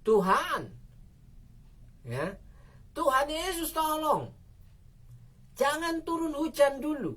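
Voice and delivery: high pleading cry to Jesus; crying out to God. Retold or imitated. Preacher retelling his prayer, high pleading cry. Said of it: "saya langsung teriak kepada Tuhan".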